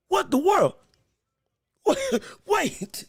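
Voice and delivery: high-pitched, incredulous double take; laughing, high disbelief; stunned disbelief. Real, not acted. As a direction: stop short in amazement, voice jumping up. Stunned by the opponent's reach.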